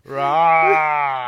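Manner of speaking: roar. Retold or imitated. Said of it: "in my room going".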